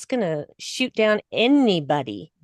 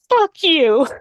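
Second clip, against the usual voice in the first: defiant; 7 semitones higher, 7 dB louder than usual. Retold or imitated. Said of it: "she is just like".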